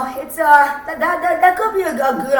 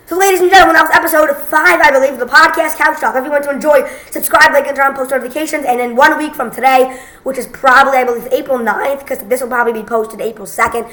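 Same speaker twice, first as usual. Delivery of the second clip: loud, upbeat podcast outro; announcer voice; 6 dB louder than usual. Acted. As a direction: sign off like a show host.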